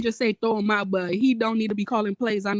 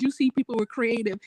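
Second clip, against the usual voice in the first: normal talking voice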